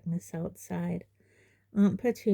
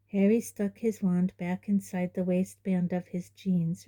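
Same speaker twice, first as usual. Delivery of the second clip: usual speaking voice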